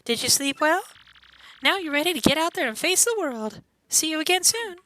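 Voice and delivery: high, lilting innkeeper voice; welcoming innkeeper. Acted. Innkeeper offers a bed for five coins, high lilting welcome. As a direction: pitch the room in a high, sweet put-on voice.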